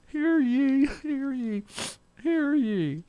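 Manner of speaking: very high, loud ringing proclamation; town crier. Pretend. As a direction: belt it out like a village crier. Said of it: "That's the town crier".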